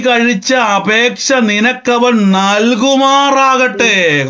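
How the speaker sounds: high, very slow proclamation; declaimed blessing. Real, not acted. Preacher reciting Eli's blessing to Hannah, slowly declaimed. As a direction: stretch every word as a solemn pronouncement.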